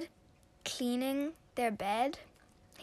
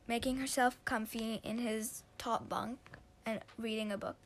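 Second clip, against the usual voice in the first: ordinary delivery, nothing marked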